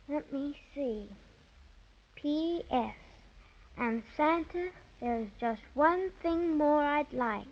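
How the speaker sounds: soft, slow muttering over her letter; half under her breath, puzzling out the spelling; murmuring to herself. Acted. Girl mumbles to herself while writing to Santa, quietly.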